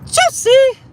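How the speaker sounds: a full scream of Juicy out the window; shouted Juicy. Pretend. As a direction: yell Juicy as loud and high as you can.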